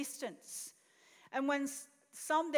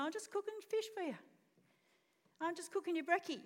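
soft, higher, quick and breezy; light, casual kindness; 5 semitones higher, 3 dB quieter, faster than usual. Acted. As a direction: toss it off gently, no reproach. Gentle Jesus against expected angry Jesus.

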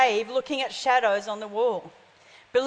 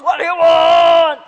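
very loud, drawn-out roar; big wizard voice; 4 semitones higher, 13 dB louder, slower than usual. Retold or imitated. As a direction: bellow slowly like an all-powerful wizard. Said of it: "there's this big voice".

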